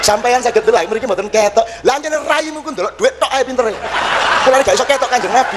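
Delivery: acted indignant comeback to a policeman; old man retorting. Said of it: "Terus polisi ini".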